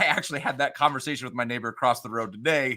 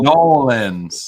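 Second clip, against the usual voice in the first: slow, drawn-out New Orleans drawl, louder; New Orleans accent; 6 dB louder, slower than usual. Shown as a demonstration. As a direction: stretch it out like a New Orleans local. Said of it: "in a New Orleans accent".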